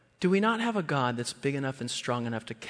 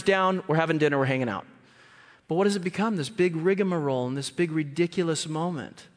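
ordinary talk, nothing marked

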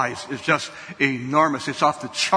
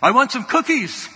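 lightly voiced four-year-old, higher and whiny; little boy wanting cookies; 7 semitones higher than usual. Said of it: "I heard him say".

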